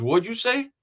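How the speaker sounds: Greek customer. Acted. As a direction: ask as the teasing customer. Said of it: "the Greek goes".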